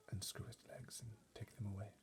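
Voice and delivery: unvoiced whisper. A narrator's reading. Butler ordering footmen, in a whisper. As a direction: say it on breath alone. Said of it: "Mister Tibbs whispered".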